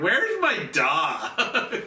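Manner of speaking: put-on slow, slurred Valley Girl whine; drunk Valley Girl. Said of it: "this perfect Valley Girl voice".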